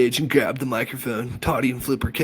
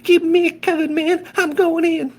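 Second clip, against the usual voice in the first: very high, loud falsetto with a hee hee; 8 dB louder than usual. A narrator's reading. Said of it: "in his best Michael Jackson voice".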